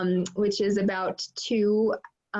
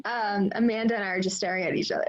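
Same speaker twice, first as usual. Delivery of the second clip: usual speaking voice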